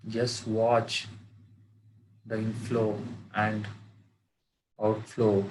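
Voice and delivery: measured meditation guide with long pauses; guided meditation. Acted. Teacher guiding friends to watch their breath, guided meditation. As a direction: lead them into stillness, phrase by phrase, in an even tone.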